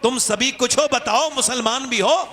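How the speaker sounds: couplet recited rising to a loud ringing finish; poetic recitation. Acted. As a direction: declaim Iqbal's verse to the crowd, building up. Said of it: "Iqbal कहता है".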